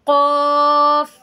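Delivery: very slow, loud, sustained "क़ाफ़" with a heavy back q; full-mouthed qaf. Shown as a demonstration. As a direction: let "क़ाफ़" fill the mouth, stretched out. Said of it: "ये आवाज़ भरकर आएगी मुंह से".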